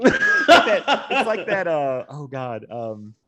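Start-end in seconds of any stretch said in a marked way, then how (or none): 0.0-1.1 high squeaky laugh through the words; words breaking into giggles. Real, not acted. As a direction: laugh it out, pitched way up. Laughing while searching for words.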